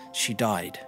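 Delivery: ordinary delivery, nothing marked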